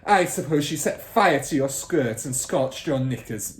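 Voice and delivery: loud, scornful sneer; snorting scorn. Acted. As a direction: snort it with contempt. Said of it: "Miss Trunchbull snorted".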